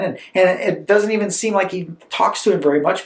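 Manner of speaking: ordinary delivery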